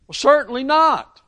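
pitched high, emphatic; outraged denial. Acted. Preacher voicing Paul's outraged refusal to his congregation. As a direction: recoil from the very idea. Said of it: "carries a sense of outrage".

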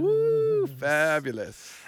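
high whoop then a slow fabulous; excited whoop. Acted. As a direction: cheer it, then savour fabulous. Cheering the co-host.

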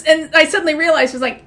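usual speaking voice

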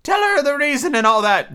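high, plaintive turtle voice; a high sad creature voice; Mock Turtle voice.

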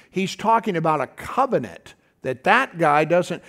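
ordinary talk

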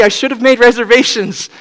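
high, loud apologetic plea; pleading Joseph. Retold or imitated. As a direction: sound sorry and desperate. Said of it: "Joseph saying".

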